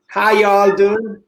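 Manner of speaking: slow, drawled American "how y'all doing"; playful American accent, stretched out. Pretend. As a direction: put on a Southern American accent. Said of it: "the most American thing you can think of in an American accent".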